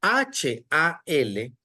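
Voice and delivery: "H A L" spelled letter by letter, very slowly; spelling "H A L" for students, slowly. Shown as a demonstration. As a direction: sound out each letter one at a time. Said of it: "Lo voy a pronunciar en español".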